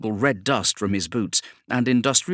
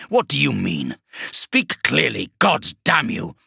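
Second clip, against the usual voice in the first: angry warsmith; 5 semitones higher than usual. Acted. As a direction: spit the curse at the demon. Warsmith spits a curse.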